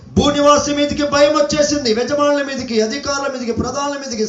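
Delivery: sustained, ringing prophetic proclamation; preaching. Real, not acted. Preacher warning earth's inhabitants from scripture, full preaching voice. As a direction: proclaim it like a warning, stretching the endings.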